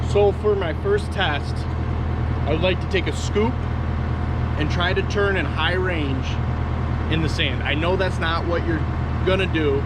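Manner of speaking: talking loud over a running machine, pitch up; half-shouting to the camera over machine noise. Real, not acted. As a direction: project over the engine noise from the cab. Talking over a running skid steer.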